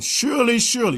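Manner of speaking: high, loud doubled surely; strong emphasis on both surely; emphatic surely. Shown as a demonstration. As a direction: hammer surely twice. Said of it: "inna is a very strong, emphatic particle".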